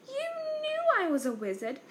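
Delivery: high, stunned "you knew"; can't believe they hid it; shocked disbelief. A narrator's reading. Harry stunned, asking the Dursleys, high and shocked.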